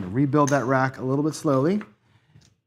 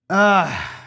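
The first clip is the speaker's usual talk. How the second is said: frustrated groan; 5 semitones higher, 8 dB louder than usual. Real, not acted. Losing roll.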